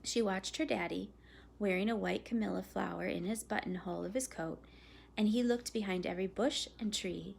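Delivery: ordinary delivery, nothing marked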